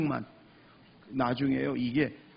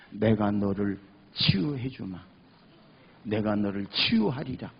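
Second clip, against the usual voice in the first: voice of God; 6 semitones lower than usual. Retold or imitated. God answering a praying man, voice of God. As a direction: pronounce it low and measured, as God. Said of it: "하나님은 그러시더래요".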